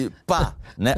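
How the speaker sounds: ordinary delivery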